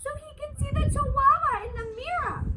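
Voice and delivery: high little sisters talking; sing-song small girls. A narrator's reading. Sisters voiced in story.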